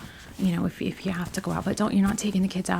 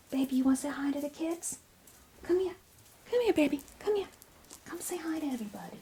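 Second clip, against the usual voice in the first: high coaxing call to a pet; coaxing the cat; 6 semitones higher, 4 dB quieter, faster than usual. Real, not acted. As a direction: coo higher to lure the cat over.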